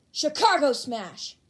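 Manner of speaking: loud, slow battle cry; yelled attack name. A narrator's reading. Hero yelling his attack mid-fight, full shout. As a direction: shout the move name as he leaps. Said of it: "he yelled".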